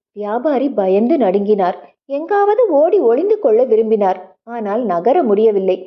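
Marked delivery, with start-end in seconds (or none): none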